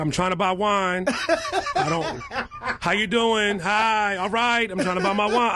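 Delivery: high laughing mock of a bothered star; annoyed celebrity. Lynn Whitfield buying wine, high laughing impatience. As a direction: brush off a fan while shopping. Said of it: "she said".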